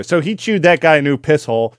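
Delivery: normal talking voice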